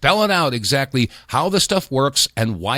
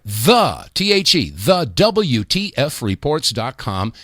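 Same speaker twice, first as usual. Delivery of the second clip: "the" hit hard, then spelled T H E, then "the W T F reports dot com" slowly; slow, careful web address with a punched "the"; spelled out and stressed; slower than usual. Shown as a demonstration. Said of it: "don't forget that magic word".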